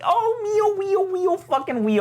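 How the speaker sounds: very high-pitched theatrical wail; over-the-top high mock moaning; mock lament. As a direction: wail woe in an exaggerated high voice. Mock lament while recapping abandonment by God.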